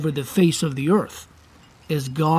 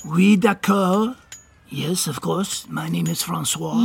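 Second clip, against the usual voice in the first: put-on French accent; 2 semitones higher than usual. Francois introduces himself to Missus Gunther, French accent. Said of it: "your lovely French accent".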